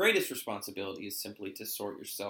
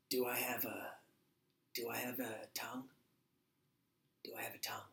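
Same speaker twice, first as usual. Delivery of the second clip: put-on whispered, frail old master; 7 dB quieter than usual. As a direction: breathe it like a dying old man. Dying old master speaks.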